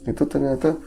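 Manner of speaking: ordinary talk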